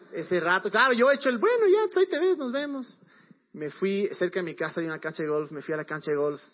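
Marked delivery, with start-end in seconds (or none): 1.4-3.0 mock casual goodbye. Acting cool after breakup.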